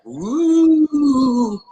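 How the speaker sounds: high hooting ghost woo; ghost moan. Retold or imitated. Caller imitated moaning like a ghost. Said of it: "sounding like a ghost".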